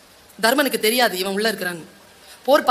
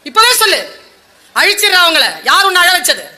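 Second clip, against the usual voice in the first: furious demand; 5 semitones higher, 5 dB louder than usual. Retold or imitated. Krishna demands who hurt her.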